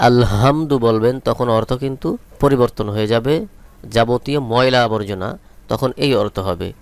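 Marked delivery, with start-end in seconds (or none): none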